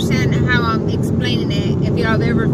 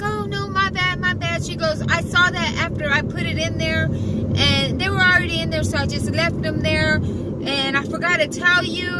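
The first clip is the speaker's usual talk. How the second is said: higher apologetic coworker voice; the coworker who stacked the pallets, apologizing in a higher voice; 4 semitones higher than usual. Retold or imitated. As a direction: sound sheepish and sorry, pitched up. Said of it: "And she's like".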